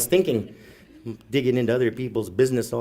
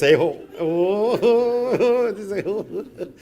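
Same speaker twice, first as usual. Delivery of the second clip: ordinary delivery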